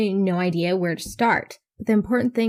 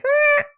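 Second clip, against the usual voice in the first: short high-pitched mock "ah"; mimicked outcry; 5 dB louder than usual. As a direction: squeal like the people reacting. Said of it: "then people are like".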